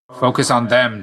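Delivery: urgent battle command. Acted. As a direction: order an ally sharply mid-fight. Combat order to an ally.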